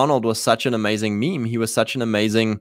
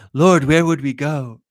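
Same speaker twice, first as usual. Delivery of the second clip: devoted disciples; 3 semitones higher, slower than usual. Retold or imitated. Disciples answer Jesus.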